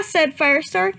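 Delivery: plain reading voice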